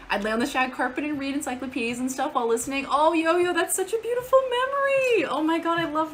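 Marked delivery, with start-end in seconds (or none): 2.9-6.1 gushing. Real, not acted. Gushing at a viewer's story.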